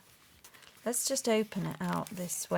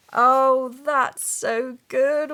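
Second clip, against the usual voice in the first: loud, slow, higher delight; thrilled; 5 semitones higher, 9 dB louder, much slower than usual. Real, not acted. Crafter seeing grunge houses, loud slow delight. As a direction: savour each word, excited.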